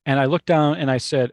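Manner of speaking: normal talking voice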